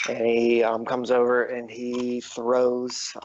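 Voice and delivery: ordinary delivery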